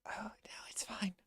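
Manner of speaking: hushed, high whispered reply; whispered reassurance. Acted. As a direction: whisper back to brush off an apology.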